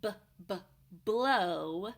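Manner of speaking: "B, b, blow" with the b sound isolated twice, "blow" said loud; beginning sound demo. Shown as a demonstration. Teacher modelling the b sound in blow for parents. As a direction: sound out b, then land hard on "blow". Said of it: "start with the, the b".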